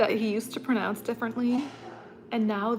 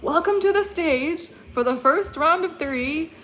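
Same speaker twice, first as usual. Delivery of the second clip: excited DJ announcer; 6 semitones higher, 6 dB louder than usual. Retold or imitated. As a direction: build to a big announcement. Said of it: "It literally says it like this".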